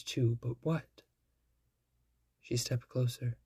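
ordinary delivery, nothing marked

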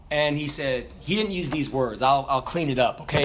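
ordinary talk, nothing marked

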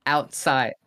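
OUTSIDE starting with an open "ow"; model pronunciation. Shown as a demonstration. Friend coaching a Canadian out of his accent, model pronunciation. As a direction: say OUTSIDE as "ow-t-side", not "oot". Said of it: "That doesn't seem right".

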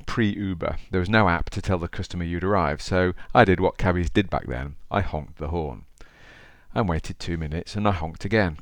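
ordinary delivery

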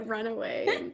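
usual speaking voice

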